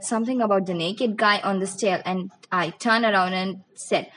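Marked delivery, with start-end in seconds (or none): none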